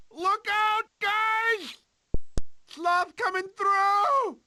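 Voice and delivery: high, loud warning yell; panicked shout. Acted. Sid racing past his friends, shouting a warning. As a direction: yell it out while running for your life.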